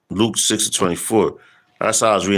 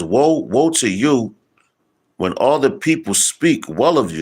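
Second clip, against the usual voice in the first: scripture reading; 4 semitones higher, slower than usual. Acted.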